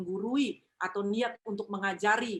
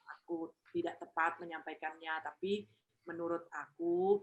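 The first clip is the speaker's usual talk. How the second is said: quiet, hesitant, humble plea to a parent; humble, soft apology; 3 semitones lower, 3 dB quieter, slower than usual. Shown as a demonstration. Child gently giving an opinion to a parent, softly and humbly. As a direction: ease in gently, asking permission. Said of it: "gunakan kalimat, intonasi, tekanan, nada itu dalam kerendahan hati".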